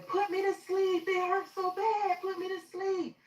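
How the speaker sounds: high, slow, anguished begging; retold distressed wail; retold crying plea. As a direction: beg through the pain, drawn out and high. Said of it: "So I'm screaming. I'm crying. I'm stressing".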